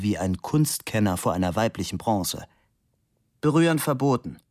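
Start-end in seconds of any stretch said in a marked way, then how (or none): 3.4-4.4 higher-pitched unfriendly prohibition; stern sculptor laying down a rule. A narrator's reading. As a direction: warn them off sternly. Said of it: "klang äußerst unfreundlich".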